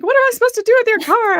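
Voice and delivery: fast, very high and loud mom voice; frantic mom. Retold or imitated. Mom asking about the car, high and fast. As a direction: squeal it like an exasperated mother. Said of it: "She's like".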